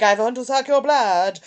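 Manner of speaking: mock Dracula accent; lightly put-on vampire voice; hammed-up European vampire. Mocking overdone dubs, lightly doing a European vampire. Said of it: "full on, really European".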